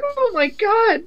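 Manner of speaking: high, shrieking, hammed-up panic; hysterical damsel. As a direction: ham up a frantic helpless heroine. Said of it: "Then it's like".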